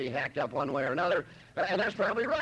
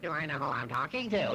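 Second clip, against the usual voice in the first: sneering mock echo of his words; mocking mimicry; 2 semitones lower than usual. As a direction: throw his line back mockingly. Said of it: "She'd mimic me as only the way them Irish could do it".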